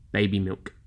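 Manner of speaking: ordinary talk, nothing marked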